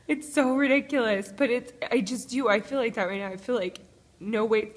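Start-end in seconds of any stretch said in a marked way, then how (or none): 0.1-2.5 higher, louder through tears; half-laughing at herself while crying; tearful words, breaking up with emotion. Real, not acted. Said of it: "Why am I crying?".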